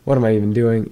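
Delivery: ordinary talk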